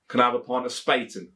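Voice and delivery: "Can I have a pint of Spaten?" in broad Leicestershire; Leicestershire accent. Shown as a demonstration. To a Leicester barman, in a Leicestershire accent. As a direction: own Leicester accent, down to earth. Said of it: "with a Leicestershire accent like myself".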